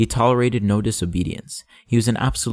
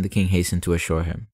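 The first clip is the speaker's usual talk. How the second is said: plain reading voice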